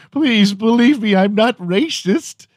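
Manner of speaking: high-pitched, hammed-up pleading; mock pleading. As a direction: plead comically high, begging to be believed. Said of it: "I'm pleading with you here".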